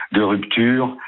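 ordinary delivery

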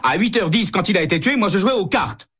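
high, indignant protest from the cornered man; pleading his alibi, pitched high; exasperated suspect. Acted.

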